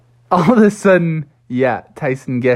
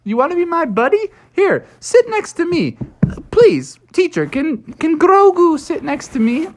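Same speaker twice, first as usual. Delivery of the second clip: put-on high, eager kid frog voice; excited Froggy; 12 semitones higher than usual. Froggy greets Baby Yoda, high and thrilled. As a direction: gush at your new green friend. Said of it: "Froggy was like".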